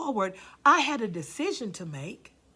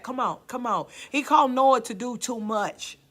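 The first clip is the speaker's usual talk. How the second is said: usual speaking voice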